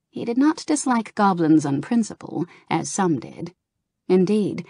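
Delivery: plain reading voice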